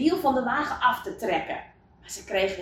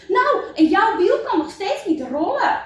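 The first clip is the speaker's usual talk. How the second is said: high, louder indignant comeback; quarrelsome retort; 10 semitones higher, 6 dB louder than usual. Acted. Villager answering back about the wheel, indignant. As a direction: hit back in a heated storybook squabble.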